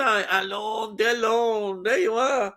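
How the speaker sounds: high, drawn-out mock French; hammed-up French, pitched well up; attempted French accent. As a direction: stretch it out in a bad French accent. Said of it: "I just can't do the French accent".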